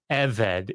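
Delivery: eved, hit the first syllable EV; stress on first syllable. Shown as a demonstration. Teaching the audience the Hebrew word, stress on first syllable. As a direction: say eved as EH-ved, weight on EH. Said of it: "The emphasis is on the first syllable".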